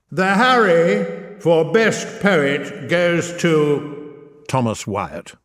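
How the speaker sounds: grand, slow, drawn-out awards host voice; milk the suspense like an Oscars presenter; awards announcer. Pretend. Host reading out the Best Poet award, in a grand slow announcer voice.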